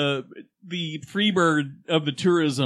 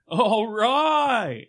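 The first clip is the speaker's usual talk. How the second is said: drawn-out, higher "All right"; Walter impression; 8 semitones higher than usual. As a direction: stretch it out like Walter. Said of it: "when Walter shows up".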